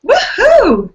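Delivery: high, loud happy whoop; excited cheer. Real, not acted. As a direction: cheer it out with delight. Cheering at endorsement permission.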